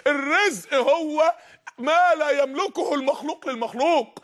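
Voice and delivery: very slow, each word laid out; slow solemn definition. Real, not acted. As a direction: spell the meaning out slowly. (تعريف بطيء جداً ومهيب)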